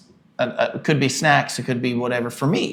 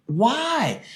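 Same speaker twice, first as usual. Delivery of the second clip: exasperated why; 11 semitones higher than usual. Retold or imitated. Baffled husband sees wife's trinket.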